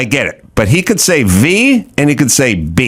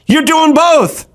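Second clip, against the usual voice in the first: much higher, frustrated lecturing; exasperated high voice; 10 semitones higher than usual. Retold or imitated. Frustrated customer schooling the barber on his V and B. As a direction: throw up your hands at him.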